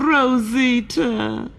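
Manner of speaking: high, very slow calling of her name; Pedro pleading. Acted. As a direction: stretch the name out with a rising end.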